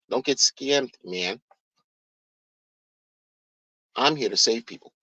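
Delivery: low, hushed mock of a crypto founder; a low, soft send-up of the guru's pitch. Mocking crypto founder again.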